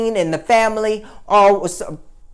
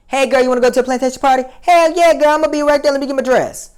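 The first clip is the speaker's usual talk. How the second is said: put-on high, fast, gushing party-girl voice, getting higher and louder on the yes; mock party girl; 8 semitones higher, 4 dB louder, faster than usual. Girls inviting each other to a plantation party, mocked in a high, eager voice. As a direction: ham up an eager sorority girl jumping at an invite.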